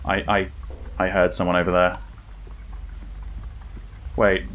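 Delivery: normal talking voice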